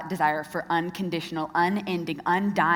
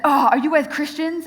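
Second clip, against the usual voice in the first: mock-bright challenge; 6 semitones higher, 6 dB louder than usual.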